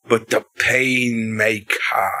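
low, slow, drawn-out villain reveal; dramatic promo reveal. Retold or imitated. Friend quoting Jericho's promo, slow and low. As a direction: announce the Painmaker like a menacing wrestler.

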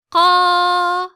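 Ka from the back of the mouth, high and loud; deep full Ka. Shown as a demonstration. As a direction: strike Ka full from the tongue root. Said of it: "kalın ve tok bir sesle".

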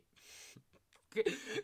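real high, breathless burst of laughter. Real, not acted. Actor breaking in a serious scene, bursting laugh.

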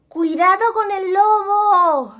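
loud, slow call from far off; warning call from afar. A narrator's reading. Naf Naf calls out to his brothers, slow and loud. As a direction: call the warning across the snow. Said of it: "les advirtió desde lejos Naf Naf".

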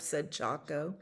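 ordinary delivery

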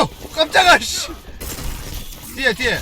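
jumpy startled yelp; startled gamer. Real, not acted. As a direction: caught off guard, blurt warnings. Startled by enemy behind.

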